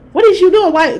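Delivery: high, loud, baffled what-are-you-doing; startled outburst. Retold or imitated. Retelling meeting kind strangers, a loud bewildered cry. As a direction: shoot it out in disbelief. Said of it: "I was like".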